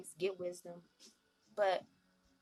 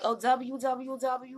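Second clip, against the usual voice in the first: proud announcement; 4 semitones higher, 5 dB louder, slower than usual. Pretend. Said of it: "we're gonna be like".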